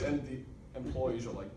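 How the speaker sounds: ordinary talk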